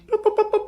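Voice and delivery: high, far-off imitated beeps; radio beeps. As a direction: mimic the walkie-talkie chirp. Imitating walkie-talkie beeps.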